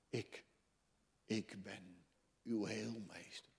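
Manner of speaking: usual speaking voice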